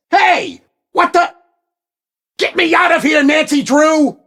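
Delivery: high, loud trapped yell; furious shout. Acted. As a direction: bellow at her to let you out. Villain trapped, yelling at Nancy.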